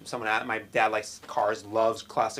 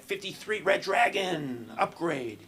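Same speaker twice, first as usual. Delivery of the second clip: high, drawn-out game announcer; mock video-game fanfare voice; app announcement; 7 semitones higher, slower than usual. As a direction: proclaim it like a level-up alert. Mimics app's streak announcement.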